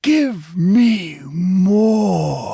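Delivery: very slow, loud, high creature cry; hungry demand. Acted. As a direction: wail the demand with desperate greed. Creature demanding more memories.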